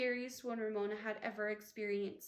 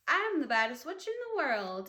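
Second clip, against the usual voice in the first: muffled witch voice; 3 semitones higher, 7 dB louder than usual. A narrator's reading. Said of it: "her muffled voice skipping".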